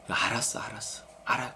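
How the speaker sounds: near-whispered reassurance; acted hushed soothing. Preacher voices God soothing a hurting believer, hushed. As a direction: murmur it very softly to console.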